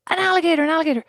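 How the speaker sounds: high mock alarmed shout; a man shouting about an alligator in the swamp; retold shout. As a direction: call it out pointing. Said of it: "starts shouting".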